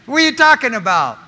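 high, loud, quick objection; loud incredulity. Acted. Imagined churchgoer challenging the preacher, loudly incredulous.

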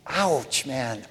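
small, soft "Ouch"; mock wince. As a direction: quietly feign being stung. Mock wince at convicting verse.

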